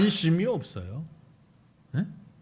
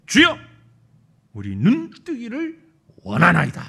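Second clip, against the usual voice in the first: clear, firm plea; 7 dB louder than usual. Acted. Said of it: "명확하게, 간결하게, 확실하게 대답합니다".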